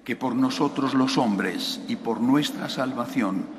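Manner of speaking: steady liturgical recitation; recited Creed. Real, not acted. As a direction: recite the Creed evenly with the congregation.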